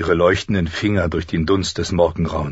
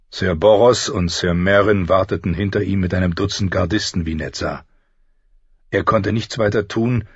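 usual speaking voice

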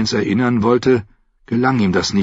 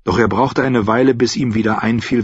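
ordinary delivery

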